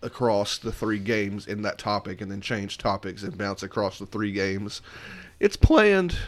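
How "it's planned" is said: voice cracking. Real, not acted. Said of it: "my voice just cracked back there".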